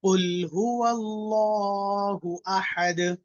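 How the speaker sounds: slow, melodic tajweed recitation with a long held final vowel; measured chanting recitation, the last word drawn out; Quranic recitation. Shown as a demonstration. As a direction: recite it slowly for the class to echo. Teacher recites for class to repeat.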